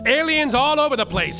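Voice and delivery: ordinary delivery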